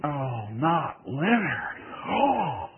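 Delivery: a half-sob of dread; tearful dread. Retold or imitated. Young preacher fearing his mentor's verdict, slow and tearful.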